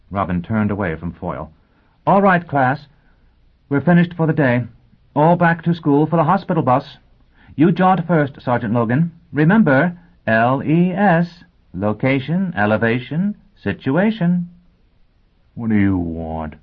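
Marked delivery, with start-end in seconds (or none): 2.1-14.5 high, loud, projected teacher voice; addressing the class. Acted. Teacher dismissing class for the hospital bus, loud and high. 15.5-16.6 growled. Acted. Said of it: "Foyle growled".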